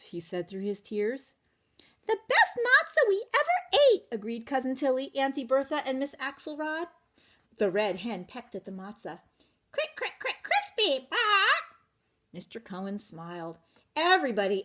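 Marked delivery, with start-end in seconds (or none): 2.1-4.1 high, loud gushing praise; delighted aunties. Acted. Relatives at the Seder praising the matzah, high and gushing. 9.7-11.8 high, squawking pecks; clucking hen. Acted. Red hen pecking at the matzah, squawking high.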